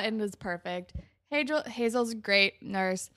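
ordinary talk, nothing marked

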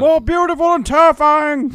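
high, loud, hammed-up proclamation; over-the-top epic declaiming; mock Galadriel speech. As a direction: thunder it like a terrible fantasy queen.

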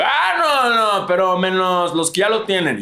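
squeaky mock league official; hammed-up high-pitched excuse. League official voiced in retelling.

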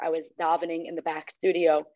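ordinary talk

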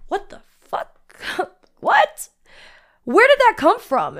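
high, hushed shock breaking into a laugh; stunned disbelief. Retold or imitated. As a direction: replay being floored by your own words. Shocked at her own outburst.

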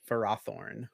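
Ferrothorn as fuh-RAH-thorn; correct pronunciation. Shown as a demonstration. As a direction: say Ferrothorn with a short first syllable and "ah" second. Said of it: "It's pronounced Ferrothorn".

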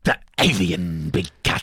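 slow, drawn-out title reveal; dramatic announcer. Acted. Host finishes co-host's aka, dramatic announcer. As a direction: draw out each word like a trailer voice.

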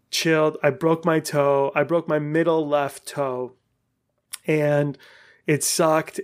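ordinary talk, nothing marked

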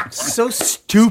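giggling through the words; laughing. Real, not acted. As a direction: laugh as you say it. Laughing at band name.

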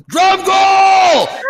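high, drawn-out mock Stallone yell; yelling a name. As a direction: holler it long and high, imitating a movie hero. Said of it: "He's, like, yelling his name".

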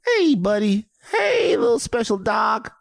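put-on high, sing-song baby talk to a dog. Shown as a demonstration. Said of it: "What's yours?".

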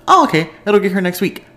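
mock naive self. Mocking her own naive past self.

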